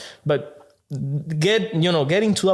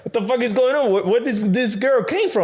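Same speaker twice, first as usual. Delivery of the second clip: higher incredulous retelling; pitched-up mock disbelief; baffled outrage; 6 semitones higher than usual. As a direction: ask where she came from, stunned. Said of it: "And I'm like".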